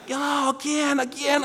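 high, slurred mumble; can't enunciate. Acted. Patient failing to carry on a conversation, high and slurred. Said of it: "I can't, I can't enunciate".

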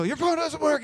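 high, frantic outburst at the phone; frantic complaint. Retold or imitated. Stranded kid to the fast-food worker, high and frantic. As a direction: blurt it out in high-pitched panic. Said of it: "I go".

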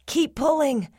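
high, strained urging; grunted. A narrator's reading. Cyril pushing Melvin up, grunted with strain. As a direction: urge it through heavy effort. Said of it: "Cyril grunted".

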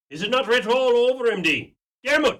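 high, fast, loud nudge; loud prompting call. Acted. Sister calls sharply to Dermot to back her up. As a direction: call out to wake him up.

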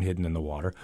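usual speaking voice

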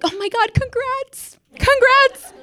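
hammed-up shrieky congratulation; mock gushing congratulations. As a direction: squeal it like overexcited coworkers. Imitating gushing coworkers congratulating her.

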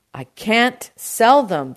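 slow, loud, high protest; shouting. A narrator's reading. Produce man refuses uncle's pomegranates, shouting. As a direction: shout it out slowly. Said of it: "the produce man shouted".

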